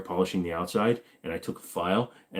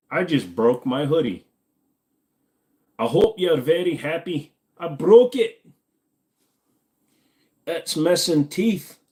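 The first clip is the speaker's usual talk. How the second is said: higher, louder mock narration; put-on commentator voice; 8 semitones higher, 6 dB louder, slower than usual. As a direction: ham up the broken hoodie saga. Puts on a complaining jacket commentary.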